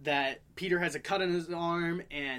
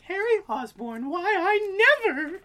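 put-on high, slow scandalised old lady; prim old aunt; 13 semitones higher, 7 dB louder, slower than usual. Aunt May scolding Harry at dinner, scandalised and prim. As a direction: clutch your pearls, shocked. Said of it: "Aunt May's like".

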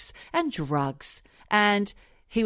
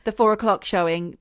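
ordinary delivery

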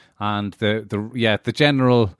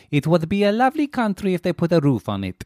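higher-pitched impression of a foreign-born mother; imitating mother; 5 semitones higher than usual. Speaker quotes mother who moved from Buenos Aires, higher imitated voice. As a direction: voice your mother's favourite saying, pitched up. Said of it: "but she always says".

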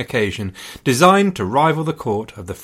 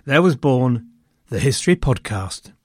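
grave ancient myth telling; 2 semitones higher than usual. Acted. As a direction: tell it like an ancient epic. Mock epic podcast origin tale.

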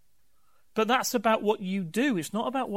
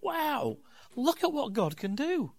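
awed wonder; 6 semitones higher, 3 dB quieter than usual. Retold or imitated. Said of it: "I'm like".

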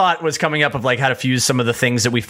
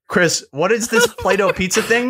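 higher, puzzled brother asking; confused brother; 8 semitones higher than usual. Retold or imitated. Brother asks Chris about the pizza, higher and baffled. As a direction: play the baffled brother pushing for answers.

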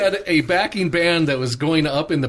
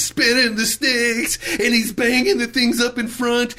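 hammed-up excitement; 7 semitones higher, slower than usual. Excitedly re-enacting a drum solo.

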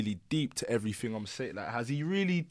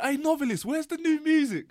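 put-on young fan calling out. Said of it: "some youth's going".

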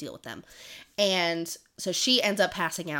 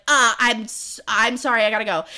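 retold flustered apology; 3 semitones higher, 8 dB louder than usual. Acted flustered escape, tries to run.

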